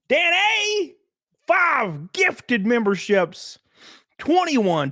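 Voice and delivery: high, drawn-out announcer hype; hyped shout-out. Real, not acted. Streamer thanking a member for gifts, high drawn-out hype. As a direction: call out the donor with stretched excitement.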